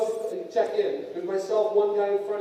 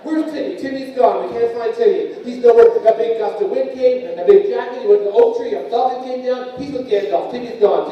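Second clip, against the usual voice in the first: loud, raised comic panic; mock panic; 4 semitones higher, 11 dB louder than usual. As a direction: shout the alarm as if a child has vanished. Mock panic over a lost kid.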